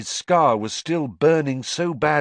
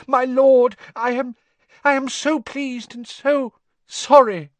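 very high, grovelling gasps; gasping; 7 dB louder than usual. A narrator's reading. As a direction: apologise in a loud, high, broken gush. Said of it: "gasped the man on the floor".

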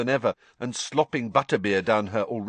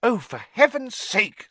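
high, exasperated outburst; cross, fed-up exclamation; angry; 14 semitones higher than usual. A narrator's reading. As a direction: snap in raised-pitch annoyance. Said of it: "said Hermione angrily".

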